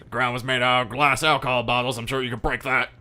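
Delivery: irate police captain. Acted. Captain jabs at the detective's drinking, loud and scornful. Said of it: "Jackson comes up to you and goes".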